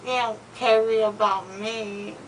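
slurred mouth full of gauze; drowsy, numb mouth talk; after wisdom teeth. Real, not acted. Still loopy with gauze in mouth.

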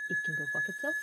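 high, loud furious squeal; squealing outburst. Real, not acted. Gamer cursing the bear in a high yell. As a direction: let the rage crack up high.

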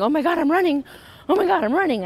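high, excited cry of disbelief; amazed exclamation. Retold or imitated. Running again for the first time in years, high and excited.

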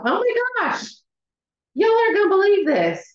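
high, fast burst of real excitement; excited squeal. Real, not acted. Host thrilled her microwaved potatoes mash so easily, squealing excitedly. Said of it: "I'm so excited".

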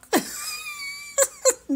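shrill burst of laughter; high laughing shriek. Real, not acted.

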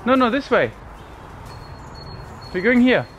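calling out. Real, not acted. Calling toddler to come.

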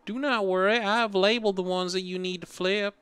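put-on Southern sweet old lady. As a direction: coo like a kindly Southern grandma. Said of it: "Southern sweet old lady".

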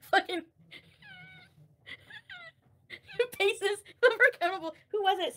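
high, loud words broken by laughter; can barely get it out for laughing; laughing hard. Real, not acted. Streamer cracking up with chat over a word, laughing loudly.

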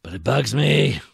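bugged and loud about it; annoyed. Real, not acted. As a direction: let the annoyance out. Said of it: "As you can tell".

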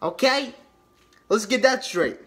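ordinary delivery

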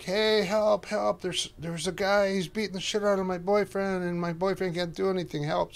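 high, pleading mock cry for help, a touch of panic; panicked cry for help. Woman begs bystanders to save boyfriend, high panicky pleading. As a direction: imitate the woman begging strangers for help.

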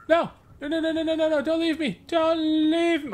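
high, loud hammed-up wail of no; mock desperate plea. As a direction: beg the leaving people like a dramatic victim. Begging departing NPCs to stay.

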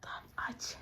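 barely audible whispered cue. Real, not acted. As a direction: whisper it so the guests don't hear. Secretly preparing the surprise.

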